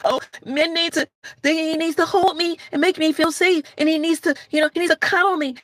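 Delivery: mocking needy woman. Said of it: "We've been told men are supposed to cuddle".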